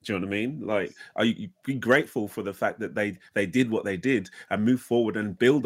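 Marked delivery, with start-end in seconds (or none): none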